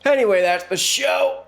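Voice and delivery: big jokey closing line; mock sign-off. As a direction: wrap it up like a showman. Joking abrupt sign-off.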